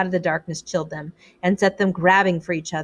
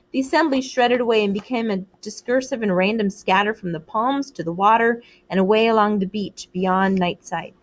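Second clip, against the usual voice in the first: usual speaking voice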